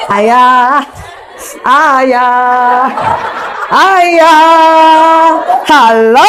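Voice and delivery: loud sing-song call across the room; playful wife calling her husband. Acted.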